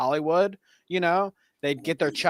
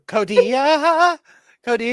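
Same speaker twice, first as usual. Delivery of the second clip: mock plaintive name-call, raised pitch; mimicking the clip; 6 semitones higher, 5 dB louder than usual. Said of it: "What was that too?".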